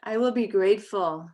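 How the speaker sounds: measured English principles with long pauses between lines; slow recitation. Shown as a demonstration. Teacher leading the class in the English principles, slow and calm. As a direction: recite each line for the class to echo. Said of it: "We're gonna say them slowly".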